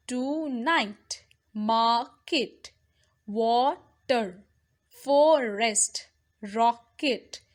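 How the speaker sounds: each word split in two beats, a small gap between; teacher breaking words into syllables. Shown as a demonstration. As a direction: sound out the two syllables separately. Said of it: "I am pronouncing it with the syllables".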